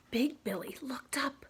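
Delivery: plain reading voice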